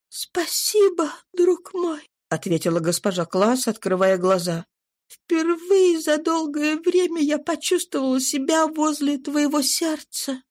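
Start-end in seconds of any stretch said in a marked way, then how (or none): 0.1-2.1 higher, slow and gentle woman's voice; tender wife's voice. A narrator's reading. Wife thanks her husband, high gentle woman's voice. 5.1-10.4 tender wife's voice. A narrator's reading. Wife thanks her husband, high gentle woman's voice.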